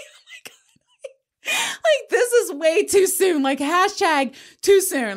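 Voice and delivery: very high, dragged-out, near-crying disbelief; high slow wailing no way; horrified shriek. Retold or imitated. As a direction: shriek it as if the idea is unthinkable. Horrified by travel suggestion.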